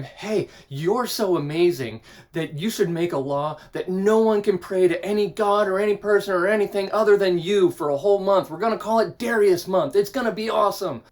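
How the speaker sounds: butter up the king like schemers; hammed-up fawning officials; flattering courtiers.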